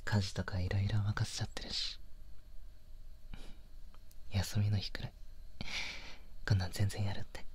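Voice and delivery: put-on soft close whisper to his girlfriend; tender boyfriend whisper, almost no voice; intimate whisper. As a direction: breathe the words right by her ear. Whispered boyfriend ASMR talk.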